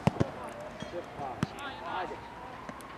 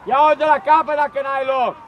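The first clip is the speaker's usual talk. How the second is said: coach shout; 2 semitones higher, slower than usual. Real, not acted. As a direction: call it across the field, slowly.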